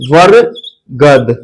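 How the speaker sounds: वरगद stretched slowly as वर-गद with a clear break; very slow split word. Shown as a demonstration. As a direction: draw वरगद out, pausing between वर and गद. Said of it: "दो शब्द में टूट रहा है".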